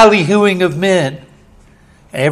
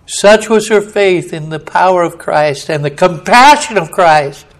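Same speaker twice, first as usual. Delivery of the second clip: ordinary talk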